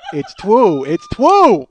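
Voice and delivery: loud mock squeal, leaping high on TWU; mock ecstatic cry. Podcaster hamming up a catchphrase, loud mock cry. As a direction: cry it out in exaggerated, rising amazement.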